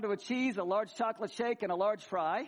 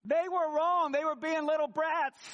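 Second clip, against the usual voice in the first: mock indignant; 5 semitones higher, 4 dB louder than usual. Retelling scolding church kids.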